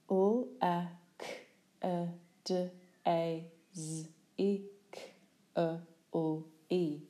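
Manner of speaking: very slowly sound out "L-A-K-A-D-A-Z-I-C-L-E" one sound at a time; each sound drawn out separately; sounded out letter by letter. Shown as a demonstration.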